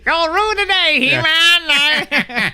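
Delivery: high, grand cartoon-hero announcement; mock heroic proclamation. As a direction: proclaim it like a triumphant cartoon herald. Mock heroic echo of friend's line.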